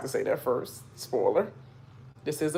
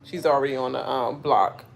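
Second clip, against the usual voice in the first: normal talking voice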